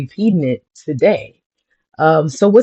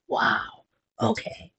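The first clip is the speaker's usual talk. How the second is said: awed whisper; 6 semitones higher, 13 dB quieter, slower than usual. Retold or imitated. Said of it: "I'm like".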